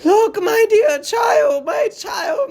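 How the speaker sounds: put-on high, loud anguished cry to her child; wild crying out. A captive mother crying out to her child, wild and high. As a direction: cry out wildly, voice breaking high. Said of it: "Another wildly".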